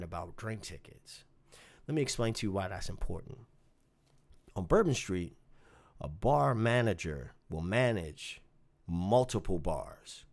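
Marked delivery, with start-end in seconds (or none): none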